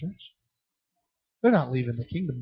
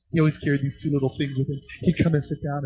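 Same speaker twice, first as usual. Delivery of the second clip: normal talking voice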